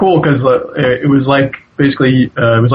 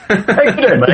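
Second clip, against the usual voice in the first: high-pitched mock Australian greeting; playful Aussie impression, laughing; put-on Australian accent; 9 semitones higher than usual. Shown as a demonstration. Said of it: "maybe I'll put on an Australian accent".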